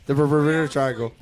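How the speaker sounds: mocking the stumble, laughing. Real, not acted. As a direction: laugh while teasing the word. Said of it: "look, I've had two".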